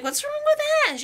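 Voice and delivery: very high, puzzled question; Misty. Acted. Girl asking Pikachu about the boy, high and puzzled. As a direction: ask it in a put-on high voice.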